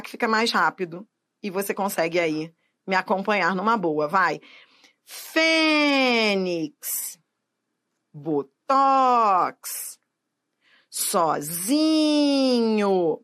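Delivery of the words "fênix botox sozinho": long held FÊ in "Fênix", TOX in "Botox", ZI in "Sozinho"; called-out, drawn-out stress on "Fênix", "Botox", "Sozinho"; exaggerated stressed syllable. Shown as a demonstration. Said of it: "Pronuncie mesmo, é pra pagar mico".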